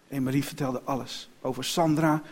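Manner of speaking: ordinary delivery, nothing marked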